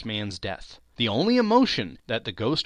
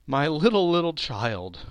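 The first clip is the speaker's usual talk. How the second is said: raised cry, falling away; grieving cry; 4 semitones higher, 3 dB louder than usual. Acted. Bob mourns his lost son Tiny Tim, grieving cry. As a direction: let grief rise, then sink. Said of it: "cried Bob".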